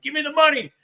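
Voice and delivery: high-pitched imitation of his holdup line; mock robber demand. Telling James how he robbed the gas station, mock robber voice. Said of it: "and I'm like".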